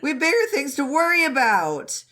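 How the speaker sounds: high, fast frantic rant; high exasperated Zhang Cheng. Retold or imitated. Zhang Cheng ranting at Wei Wuxian about the camp, high and frantic. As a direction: rant at him in a squeaky panic.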